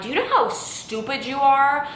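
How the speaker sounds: retelling a fight, mild mock yell; retold angry yell. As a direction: snap it back at mom, pitch up. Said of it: "I'm screaming at her".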